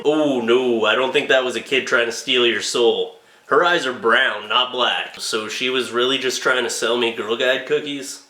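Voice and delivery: low deadpan officer voice; deadpan cop. Acted. Police officer telling the homeowner, low deadpan. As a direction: break the news flatly.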